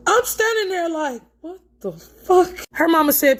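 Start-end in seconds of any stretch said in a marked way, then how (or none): 1.4-2.5 disbelief. Retold or imitated. Shocked at the mother's answer.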